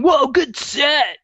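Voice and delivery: slow, drawn-out impressed fan; a gig-goer praising the wrong singer; lightly voiced fan. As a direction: stretch it like a laid-back show-goer. People praising the wrong woman.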